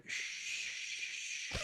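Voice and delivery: long soft hushing noise; waterfall sound. Acted. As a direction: imitate rushing water with a sustained shh. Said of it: "you do the sound".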